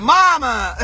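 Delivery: mock-offended cry; exaggerated protest. Shown as a demonstration. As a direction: call it out high and campy, on the edge of laughter. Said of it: "Well, not like that".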